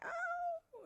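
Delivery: very high sharp yelp of pain; pained cry. Retold or imitated. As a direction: cry out at the second spasm. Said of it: "I did the same thing, like".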